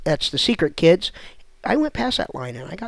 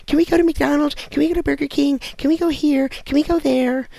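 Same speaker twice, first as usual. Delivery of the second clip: put-on higher pleading kid voice; whining kids; 6 semitones higher, 3 dB louder than usual. Kids nag tired parents for fast food, whining. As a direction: beg like a nagging child.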